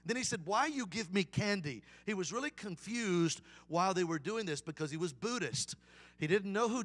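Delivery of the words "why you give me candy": put-on Vietnamese-accented question. Confused Buddhist owner asks teenagers, Vietnamese accent. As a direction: imitate a Vietnamese man's English. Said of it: "try to do a Vietnamese accent".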